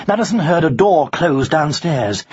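plain reading voice

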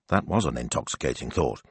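ordinary delivery

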